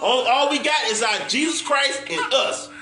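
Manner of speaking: usual speaking voice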